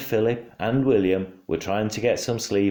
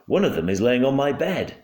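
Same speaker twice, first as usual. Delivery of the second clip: higher, lightly yelled; mock yell; 7 semitones higher, 3 dB louder than usual. A narrator's reading. Boy spotting a duck on his bed, mild mock yell. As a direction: call it out like a boy peering in a window. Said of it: "yelled William".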